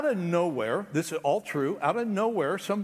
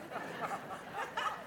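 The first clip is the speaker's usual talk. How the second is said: embarrassed laugh; 5 semitones higher, 13 dB quieter than usual. Real, not acted. Laughing at his own overalls.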